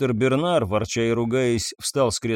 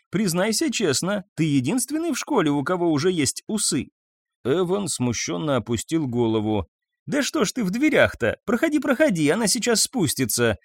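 высоко и звонко, радостно тараторя; встречает гостя, восторженно кудахчет; оживлённая хозяйка; 7 semitones higher than usual. A narrator's reading. (Марта тараторит гостю, оживлённо и высоко)

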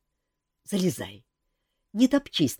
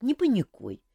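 ordinary delivery

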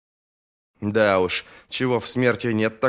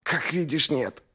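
hoarse half-whisper, quieter; raspy whisper; 6 semitones higher, 3 dB quieter than usual. Acted. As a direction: rasp it out after being choked. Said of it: "хриплым шёпотом отозвался он".

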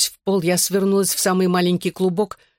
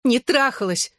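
high-pitched denial at normal volume; narrated yelp of denial; restrained cry; 7 semitones higher than usual. A narrator's reading. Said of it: "кричала я".

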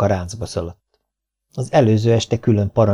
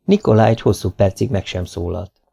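plain reading voice

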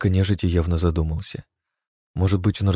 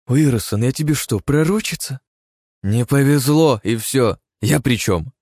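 indignant bickering; 7 semitones higher, 4 dB louder, slower than usual. A narrator's reading. Woman bickers with partner.

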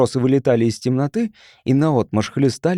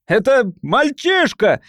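high, slow, drawn-out accusation; crying out; 10 semitones higher, 3 dB louder, slower than usual. A narrator's reading. Cornered man naming a boy to the crowd, crying out. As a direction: cry out the accusation desperately. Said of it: "выкрикнул он".

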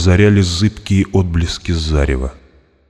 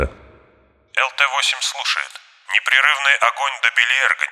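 over a pilot headset; 7 semitones higher, 6 dB quieter than usual. A narrator's reading. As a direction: answer through a crackling cockpit radio. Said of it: "немедленно отозвался голос пилота".

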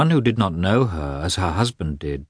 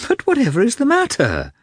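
much higher, louder, wide-eyed innocent surprise; comical surprise; 4 dB louder than usual. A narrator's reading. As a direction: play the innocent, sincerely astonished. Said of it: "in a tone of comical surprise and sincerity".

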